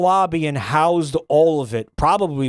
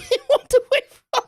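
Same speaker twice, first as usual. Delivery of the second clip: very high laugh through the words; helpless real laughter. Real, not acted. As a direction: lose it while finishing the sentence. Cracks up retelling the story.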